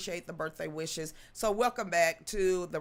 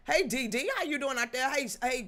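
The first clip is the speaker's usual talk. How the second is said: normal talking voice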